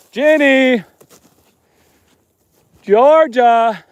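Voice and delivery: long, loud calls into the dark; shouting names into the night; calling out. Real, not acted. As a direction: call them across the field.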